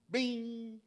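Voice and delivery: bright, high ding of realization; bell-like bing. Acted. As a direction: ring it out like a bell. Punchline sound effect.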